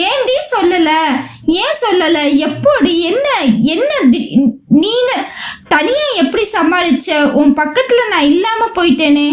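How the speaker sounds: high, distressed run of questions; agitated questioning. Acted. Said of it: "சற்று ஆவேசமாக".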